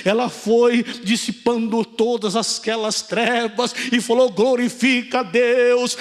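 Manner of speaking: loud, drawn-out preaching at full force; pregação fervorosa. Real, not acted. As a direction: proclaim it to the whole church, stretching the key words. (pregação inflamada em voz alta)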